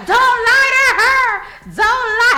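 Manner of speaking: loud, high, drawn-out mimicry; hammed-up protesting shout; retold yelling. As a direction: call it out like you're imitating someone hollering.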